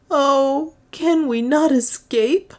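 high, plaintive moan. A narrator's reading. Juana begs Leonard for escape, moaning. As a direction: plead in a high, woeful voice. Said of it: "moaned Juana".

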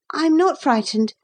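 put-on high-pitched boy's denial; plucky boy. A narrator's reading. Boy told not to be frightened, plucky. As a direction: deny it as a plucky small boy.

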